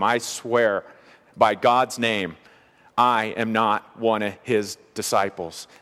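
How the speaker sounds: slow, deliberate courtroom oath; solemn oath. Acted. Peter swears before God he is no disciple, as a slow solemn oath. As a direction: swear it weightily, spacing out the phrases. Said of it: "a swear before God".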